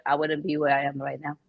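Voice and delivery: usual speaking voice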